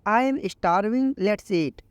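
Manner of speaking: very slow, each English word laid out clearly; slow teaching read. Shown as a demonstration. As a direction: model the sentence slowly for learners. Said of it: "इसको आप English में बोलो".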